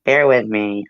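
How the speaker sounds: ordinary talk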